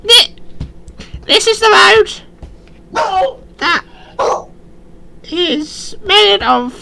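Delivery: very high, slow squeaky goblin. Acted. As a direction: draw it out in a tiny goblin squeak. Goblin answers the adventurer.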